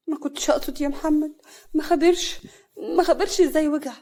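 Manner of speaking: high, whimpering excuse; acted whining apology; plaintive apology. A narrator's reading. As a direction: plead innocence in a small upset voice. Wife apologizes for broken bed.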